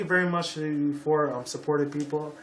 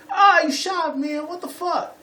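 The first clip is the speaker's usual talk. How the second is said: high, loud, slow mock cry of pain; retold pained scream; 13 semitones higher, 4 dB louder, slower than usual. Vlogger voicing a shot man, high pained yell. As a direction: scream like you've just been shot. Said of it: "he's screaming and crying".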